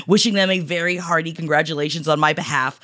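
ordinary delivery

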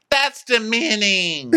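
high, slow, prissy offense; mock offended Whitney. Whitney mocked taking offense, high and slow. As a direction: drag it out, wounded. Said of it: "And Whitney goes".

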